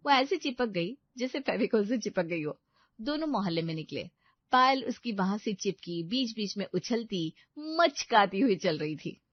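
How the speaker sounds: ordinary delivery, nothing marked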